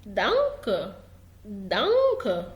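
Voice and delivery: low, slow دانك with a clear D; initial D sound. Shown as a demonstration. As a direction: stretch دانك as "DAHN-kuh". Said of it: "تقرا دال".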